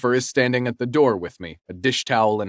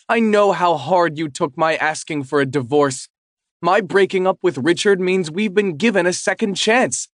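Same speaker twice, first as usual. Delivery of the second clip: higher, insistent voiced ex-wife; ex-wife pleading; 6 semitones higher, 4 dB louder than usual. Ex-wife telling husband they get a second chance, insistent. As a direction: press her claim on him. Ex-wife makes her claim.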